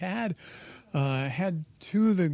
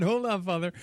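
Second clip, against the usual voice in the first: ordinary talk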